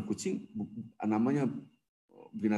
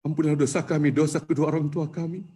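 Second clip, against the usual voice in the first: building prayer; 5 semitones higher, 4 dB louder than usual. Acted. Preacher praying for the congregation's forgiveness, building fervour. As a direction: swell the supplication toward the end.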